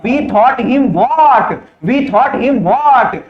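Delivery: very slowly drawn-out question to the class; slow quiz question. Shown as a demonstration. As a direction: stretch out the question for students. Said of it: "teacher quizzes the class".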